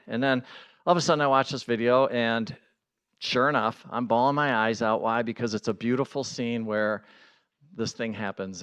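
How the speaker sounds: ordinary delivery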